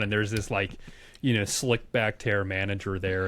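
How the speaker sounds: ordinary talk, nothing marked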